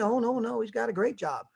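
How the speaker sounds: wife denying to the pastor; lightly voiced wife. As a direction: brush it off sweetly. Wife answering.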